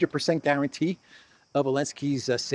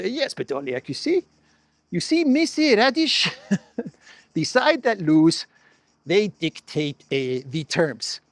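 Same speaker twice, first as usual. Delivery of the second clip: pitched-up, hammed-up Russian accent; mock Russian-accented president, slowing down to spell it out; Zelensky with Russian accent; 3 semitones higher than usual. President lecturing the journalist on who dictates terms, mock Russian accent. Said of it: "he said Kiev with the, with the Russian accent".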